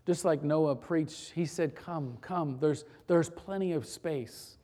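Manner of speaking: soft, low invitation to a congregation; gentle sermon appeal. Real, not acted. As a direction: invite them in quietly, leaving long pauses.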